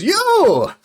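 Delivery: high, loud accusing exclamation; single shouted word; pointing cry. Acted. Old bard singling out the heroes, high and loud.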